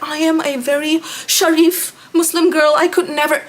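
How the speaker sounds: high, prim, put-on voice of a pious girl; mock pious girl. Vlogger mocking her friend's fake pious act. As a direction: sing it out sweetly like a pious online persona.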